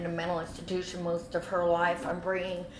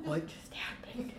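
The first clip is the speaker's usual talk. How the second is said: hushed, bewildered question; stunned whisper; 10 dB quieter than usual. Retold or imitated. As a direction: whisper it in a daze.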